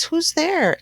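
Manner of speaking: high squeaky mouse call; a startled tiny voice up high; mouse cries out. Acted. Mouse calling into the dark den, high squeak. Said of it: "Mouse cries".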